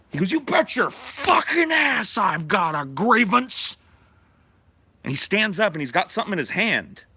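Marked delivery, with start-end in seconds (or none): 0.3-3.8 much higher, louder, slow furious yell; angry shout. Retold or imitated. Joe shouts his grievance at the union, slow furious yell. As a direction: roar it at the whole table.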